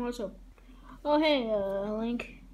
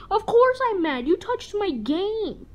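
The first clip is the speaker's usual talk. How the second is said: angry yell; 9 semitones higher, 8 dB louder than usual. Acted. Link angry at Mario.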